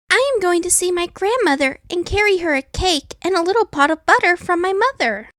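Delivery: put-on sweet, trusting young girl; little girl voice. A narrator's reading.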